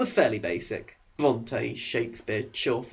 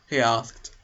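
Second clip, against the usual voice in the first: ordinary delivery, nothing marked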